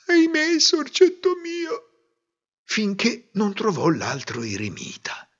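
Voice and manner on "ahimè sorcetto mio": lamenting. A narrator's reading. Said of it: "sempre ripetendo".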